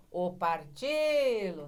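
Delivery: "partilo" called slowly as one word; calling out the word. Shown as a demonstration. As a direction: stretch the stressed "ti" in "partilo" as if calling it. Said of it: "Você pronuncia tudo junto pra chamar".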